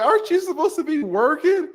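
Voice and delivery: high-pitched teasing question; mock scolding question. Acting out his flirting remark.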